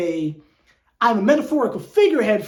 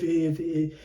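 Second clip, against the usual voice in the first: normal talking voice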